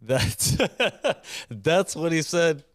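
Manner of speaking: high, cracking up mid-sentence; laughing. Real, not acted. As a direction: laugh it out, barely getting words through. Cracks up after the quote.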